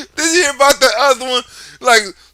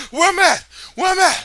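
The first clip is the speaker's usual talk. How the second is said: mock panic; 9 semitones higher, faster than usual. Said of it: "you wake up like".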